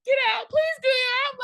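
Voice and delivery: high, loud frantic begging; panicked plea. Retold or imitated. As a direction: beg them to get out, panicking. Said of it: "talking about".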